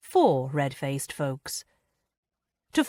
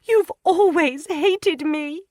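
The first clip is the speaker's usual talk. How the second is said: very high, slow sobbing accusation; weeping wife accusing her mother-in-law; 12 semitones higher, 5 dB louder, slower than usual. A narrator's reading. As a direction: sob it at your mother-in-law. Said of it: "she sobbed".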